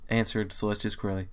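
ordinary delivery

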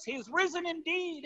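very high, strained mock shout, held soft; reply as a shouting crowd at reduced volume, pitch way up; imitated shouted response. Church shouting back to the pastor, imitated in high mock shout. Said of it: "they shouted it in response".

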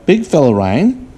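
ordinary delivery

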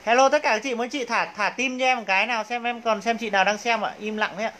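usual speaking voice

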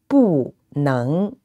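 "不" falls, then "能" rises; fourth-tone bu. Shown as a demonstration. Teacher giving a phrase to repeat, "不" falling, "能" rising. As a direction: drop "不", then lift "能" from low. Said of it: "bu, with the fourth tone".

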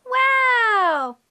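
ordinary delivery

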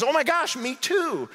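high, hammed-up delight; delighted amazement. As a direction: gush at an unbelievable coincidence. Joke man delighted at shared faith.

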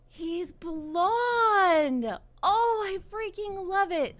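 loud, high gushing; thrilled squeal. Real, not acted. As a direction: gush loudly over the reveal. Thrilled by the child's look.

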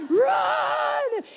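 very high, drawn-out mock yell; strained high-pitched imitation shout; imitated dad yelling. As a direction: holler it like a dad from the sidelines. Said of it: "he's, like, yelling at me".

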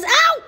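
very high sudden "au!"; sharp squeal cutting the sentence; pained yelp. Real, not acted. Woman bitten mid-scolding, high pained yelp.

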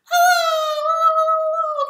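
squeaky hellos far up high and loud. Shown as a demonstration. Host saying hello as high as possible to the ceiling. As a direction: reach for the ceiling with your voice. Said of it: "as high as we can".

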